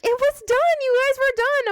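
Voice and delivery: high, loud mock coworker voice; mimicking coworker. Coworker brushing off the stopped video, high mocking voice. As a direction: squeak it out like the dismissive coworker.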